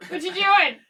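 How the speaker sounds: put-on loud, high-pitched little-kid pipe-up; mock oblivious child, blurting it out loudly; imitating Amelia. Daughter interrupting dad while hiding in the woods, loud childish voice. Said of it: "And Amelia would just be like".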